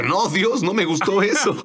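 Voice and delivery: high, whiny retold protest; mock protest. Pastor protests to God that he did not like it, high and whiny.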